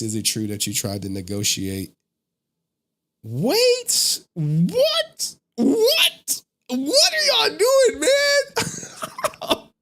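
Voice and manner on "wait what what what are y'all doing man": high, slow, crying-out disbelief; squealing what at the chat; shocked shriek. Real, not acted. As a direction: shriek it as if you can't believe them.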